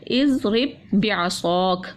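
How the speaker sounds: drawn-out Quranic recitation; slow recitation. Shown as a demonstration. Teacher reciting the verse to pupils, slow and measured. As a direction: chant the verse slowly and carefully. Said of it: "अल्लाह ताला ने कहा था".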